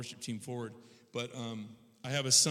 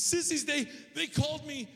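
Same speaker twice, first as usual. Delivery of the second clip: very high, mock-tearful child wail; hysterical little boy; 13 semitones higher than usual. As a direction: imitate a sobbing five-year-old tattling. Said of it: "he's hysterical".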